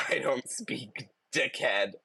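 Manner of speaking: slow, lightly British snark; mild British retort. Acted. Snarking back at the honking driver in a British accent. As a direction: drawl the put-down in a British accent. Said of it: "this one's British".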